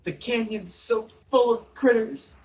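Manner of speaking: high, slow pleading through tears; tearful. Acted. Grieving woman tells the cleric through tears how he died. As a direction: choke the words out through tears. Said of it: "say through her tears".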